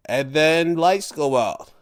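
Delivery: normal talking voice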